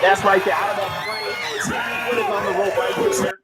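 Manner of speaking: loud, thrilled outburst; excited shout. Real, not acted. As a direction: yell it out in the moment. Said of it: "You're gonna hear me celebrate".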